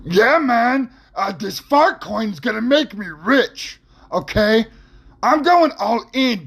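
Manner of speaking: high, dopey slacker bro voice, dragged out; Bobby Cheeto Fingers voice. Retold or imitated. Guy in line at the 7-Eleven hyping fart coin, high goofy bro voice. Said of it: "you hear Bobby Cheeto Fingers go".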